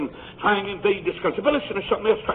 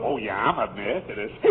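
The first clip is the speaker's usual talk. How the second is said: low, soft, self-satisfied voice; lightly mock a smug member; smug churchgoer; 10 semitones lower, 5 dB quieter than usual. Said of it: "when folks start talking like that".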